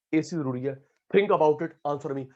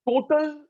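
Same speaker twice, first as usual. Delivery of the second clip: Total punched high and loud; stressed word; 11 semitones higher, 4 dB louder, much slower than usual. Shown as a demonstration. Teacher stresses total for the class, punched high. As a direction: hit total hard.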